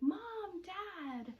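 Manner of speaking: high, drawn-out mock yell for parents; retold scream. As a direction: call out in imitation of screaming for help. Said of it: "So, like, screaming".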